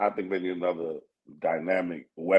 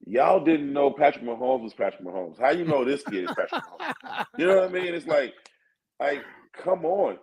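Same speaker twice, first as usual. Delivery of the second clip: exasperated disbelief; 6 semitones higher than usual. Real, not acted. To his co-host about the rookie hype, exasperated and incredulous. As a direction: pitch jumps higher, incredulous and scoffing. Arguing against the Caleb hype.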